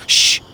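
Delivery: sharp shush. Acted. Deep Doubt hushes his brother, a sharp shush.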